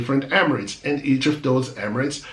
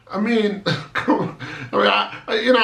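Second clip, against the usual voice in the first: laughing in disbelief, pitched up; 5 semitones higher, faster than usual. Real, not acted. Exasperated at having to explain this to people, laughing. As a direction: chuckle through the words, incredulous. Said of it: "I'm laughing because".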